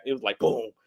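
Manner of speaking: usual speaking voice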